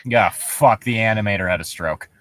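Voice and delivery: normal talking voice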